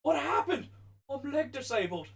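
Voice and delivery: high, hammed-up sitcom victim; sitcom character quote. Quoting the sitcom to friends, high put-on voice. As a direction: act out a bewildered comedy line, pitched well up.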